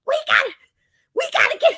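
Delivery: high, breathless fangirl squeal; frantic excitement. Retold or imitated. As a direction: squeal it, barely able to contain yourself. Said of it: "I start shaking Dave".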